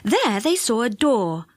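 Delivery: plain reading voice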